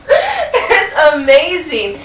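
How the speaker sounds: slow words through real laughter; laughing. Real, not acted. Laughing after play dead.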